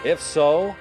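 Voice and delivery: usual speaking voice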